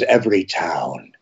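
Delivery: low, slow menacing Freddy Krueger. Retold or imitated. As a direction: drop it low and slow, sinister. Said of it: "Freddy says".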